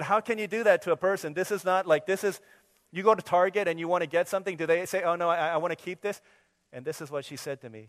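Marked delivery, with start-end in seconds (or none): 0.0-6.2 loud, indignant customer complaint; mock outrage. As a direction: protest like a wronged shopper. Mock outrage at daughter's store.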